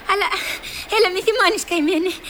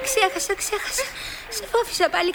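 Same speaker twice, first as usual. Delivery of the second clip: ordinary talk, nothing marked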